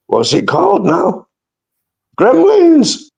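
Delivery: very high, loud exclaim; excited. Real, not acted. Finally remembering the film's name, very high and loud. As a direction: burst out with the title. Searching then exclaiming title.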